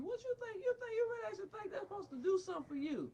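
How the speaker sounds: high, fast, scornful disbelief; sarcastic mockery. Real, not acted. Father sneering at the psychiatrist in mocking disbelief. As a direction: sneer the question up high and quick.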